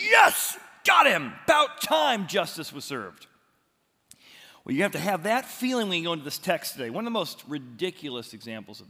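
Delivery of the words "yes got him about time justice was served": high, loud triumphant cheer. Pretend. Moviegoer cheering the villain's death, loud triumphant shout. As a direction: shout it like the villain just fell. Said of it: "you're like".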